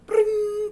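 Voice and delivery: loud, very high ringing alarm sound; alarm bell imitation. Substitute imitating the fire alarm going off. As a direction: ring like a school fire alarm.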